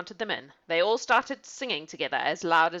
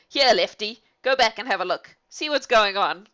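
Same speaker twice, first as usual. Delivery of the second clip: louder, bossy command to the underling; bossy order; 3 semitones higher, 7 dB louder than usual. Acted. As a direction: send him off with a loud order. Robber orders Lefty back.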